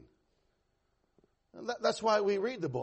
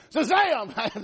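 loud, high mock shout; retold childhood scream; 8 semitones higher, 8 dB louder than usual. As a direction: holler it like a kid trying magic. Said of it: "my backyard I screamed".

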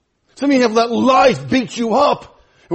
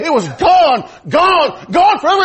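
building shout, higher and louder; rising shout; 6 semitones higher, 4 dB louder than usual. Real, not acted. Preacher proclaiming sin is gone, building to a shout.

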